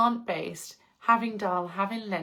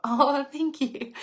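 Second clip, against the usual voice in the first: laughing thanks; 6 semitones higher, 4 dB louder, slower than usual. Real, not acted. Laughs reading a compliment.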